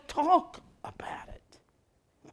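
slow, hushed whisper. Acted. Preacher hushing the congregation, whispered. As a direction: let it fade to a whisper. Preacher drops voice mid-sentence.

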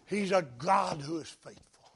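said softly, almost to himself. Shown as a demonstration. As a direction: ease it out quietly before the build. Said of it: "repeats the line louder".

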